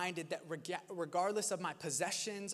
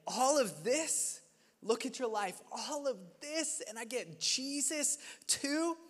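grateful wonder; 6 semitones higher than usual. Pretend. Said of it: "we chose to say".

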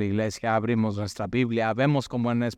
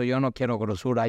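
ordinary talk

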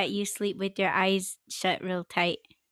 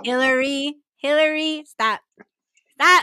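rising sharper calls to stop; scolding call; 4 semitones higher, 7 dB louder than usual. Real, not acted. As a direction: call her name, then snap stop louder.

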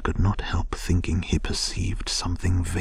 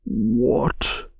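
higher outraged cry; shocked, indignant exclamation; greatly scandalized; 6 semitones higher, 4 dB louder than usual. A narrator's reading. Badger hearing Toad's backsliding, greatly scandalized. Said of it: "cried the Badger, greatly scandalized".

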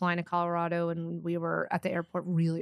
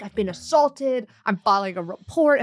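louder, slowed mock complaint; imitated indignant stranger; 2 semitones higher, 5 dB louder than usual. Stranger hit by a suitcase protests, loud mock outrage. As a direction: declare it like an aggrieved bystander, voice raised. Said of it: "he was like".

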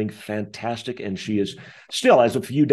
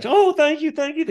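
much higher, louder tearful thank you; hammed-up tearful cleaner, much higher; gushing thanks; 5 dB louder than usual. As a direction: overflow with grateful relief. Tearful housekeeper thanks him.

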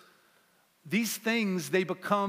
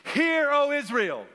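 high, loud proclaiming shout; shouted call to the congregation; with chutzpah; 9 semitones higher, 4 dB louder than usual. Real, not acted. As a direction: call it out with guts to the crowd. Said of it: "with some chutzpah, with some guts".

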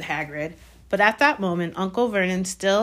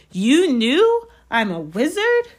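slow, high, incredulous rise; boy stunned by the revelation; astonished question; 8 semitones higher, 4 dB louder, slower than usual. A narrator's reading. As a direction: stretch it out in rising disbelief. Harry astonished by the news.

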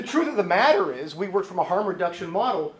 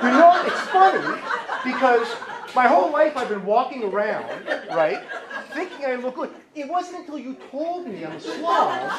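higher mock-sincere sarcasm through laughter; laughing, higher voice of a spouse pretending to be grateful; sarcastic spouse; 6 semitones higher than usual. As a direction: thank them with heavy irony.

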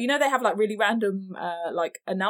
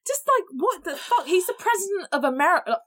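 stunned outrage; 9 semitones higher than usual. Real, not acted. Ranting about the politician, high and stunned. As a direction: let the shock pull the voice up.

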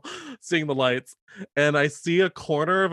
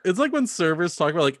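ordinary talk, nothing marked

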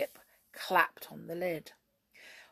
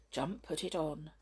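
ordinary delivery, nothing marked

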